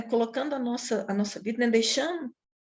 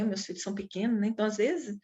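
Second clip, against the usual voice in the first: normal talking voice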